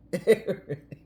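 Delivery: short burst of laughter; amused chuckle escaping; real laugh. Real, not acted. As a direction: laugh briefly at your own thought. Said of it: "I'm laughing at the way".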